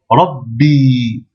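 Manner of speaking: Rabbi with the b held before the i; doubled b. Shown as a demonstration. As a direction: block the b in Rabbi then release. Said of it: "on bloque. Puis on la lit avec sa voyelle".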